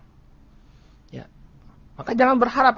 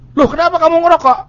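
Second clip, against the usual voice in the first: high, loud, fast startled "Loh, kenapa"; a shocked father catching his son; indignant surprise; 11 semitones higher, 7 dB louder, faster than usual. Retold or imitated. Father catches his teenage son smoking, startled and louder.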